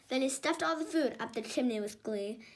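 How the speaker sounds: ordinary delivery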